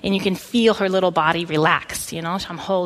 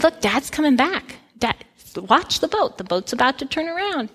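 higher, urgent calling out to comfort; urgent reassurance; 7 semitones higher than usual. Retold or imitated. Mom urging her frightened daughter to watch the boat. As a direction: point her to the boat to calm her. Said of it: "And I said".

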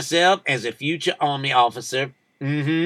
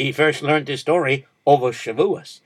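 ordinary delivery

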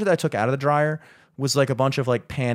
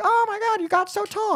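mock amazed squeal at an old schoolmate; gushing cashier. Cashier to schoolmate she forgot, mock gushing.

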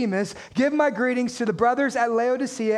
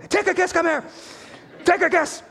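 higher-pitched mock mom calling her son; scolding mom; 5 semitones higher than usual. Mom trying to get Tychicus in trouble, mock scolding call. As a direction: call him over as his cross mother. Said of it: "his mom trying to get him in trouble".

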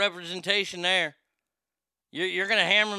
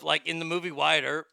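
ordinary talk, nothing marked

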